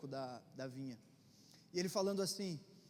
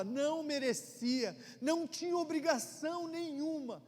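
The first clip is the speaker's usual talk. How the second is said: full-force preaching; 6 semitones higher, 7 dB louder than usual. Acted. Pastor exhorting the congregation about rescue, full-force preaching.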